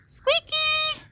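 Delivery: very high, loud shout; shouting for the cat at dusk; yelling for cat. Real, not acted. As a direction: yell the cat's name as loud as you can. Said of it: "Sorry, guys, for yelling".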